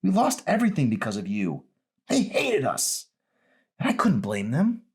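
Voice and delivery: a daughter voice pitched well up, accusing; Emery's voice. Acted. Daughter confronting her father, high and hurt.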